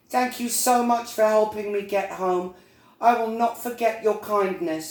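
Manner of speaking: high little bee voice; high-pitched storybook bee; Little Bee, grateful. Acted. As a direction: thank sincerely as a small bee.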